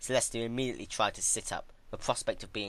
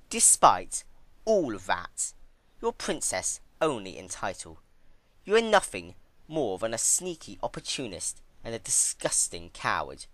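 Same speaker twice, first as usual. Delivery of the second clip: Twilight contemptuous; 3 semitones higher, 4 dB louder than usual. Acted. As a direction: tear her down piece by piece. Twilight insulting Celestia.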